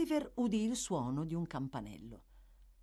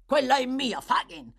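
loud, higher, forceful; angry claim; 7 semitones higher, 8 dB louder than usual. A narrator's reading. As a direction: slam your claim down. Sikes claims the money.

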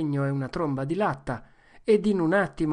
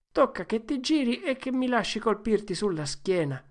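higher, indignant argument; boys' squabble; 6 semitones higher than usual. Acted. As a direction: quarrel over the rules. Boys argue over the book. (litigio tra ragazzi sulle regole del libro)

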